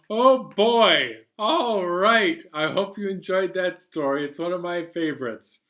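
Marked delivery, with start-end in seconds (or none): none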